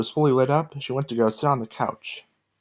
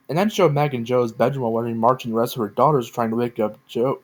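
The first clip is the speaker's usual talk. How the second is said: ordinary delivery, nothing marked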